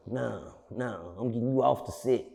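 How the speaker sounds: usual speaking voice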